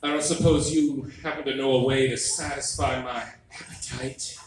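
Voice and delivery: slow, seductive purr of a wife; lightly voiced wife trying to sound sexy; sultry wife. Husband imitates his wife coming on to him, slow and sultry. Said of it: "She's like".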